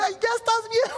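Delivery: high-pitched imitation of overwhelmed passengers; mock emotional squeal. Pastor mimics passengers grabbing each other, mock squeal. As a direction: squeal it, hammed-up and overcome.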